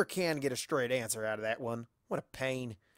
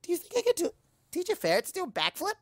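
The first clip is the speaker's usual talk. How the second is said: very high and fast; hyper chatter; faster than usual. Acted. Pinkie pitches a ferret backflip, hyper. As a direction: bubble over with silly excitement.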